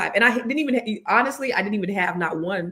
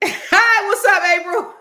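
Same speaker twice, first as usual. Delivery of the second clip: excited; 12 semitones higher, 7 dB louder, slower than usual. Real, not acted. Greeting co-host with excitement.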